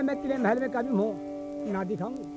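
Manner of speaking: plain reading voice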